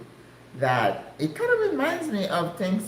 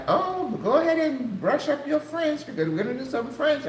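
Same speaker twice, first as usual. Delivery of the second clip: higher, lightly voiced sister; a hint of the sister's voice; 10 semitones higher than usual. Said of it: "And she's like".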